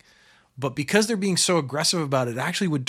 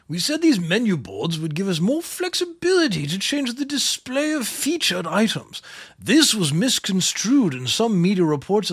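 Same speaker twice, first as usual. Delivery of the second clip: high, grand regal voice; 6 semitones higher, 3 dB louder than usual. Shown as a demonstration. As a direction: read it like a pompous monarch. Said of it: "like, a, a Regal voice".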